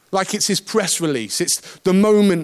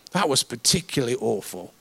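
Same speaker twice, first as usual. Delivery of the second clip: low, soft, confidential put-down; quiet aside; 7 semitones lower, 6 dB quieter, faster than usual. Retold or imitated. Churchgoer speaks to preacher after the service, quiet aside. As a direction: say it privately, voice dropped. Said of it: "waited afterwards to speak to me and say".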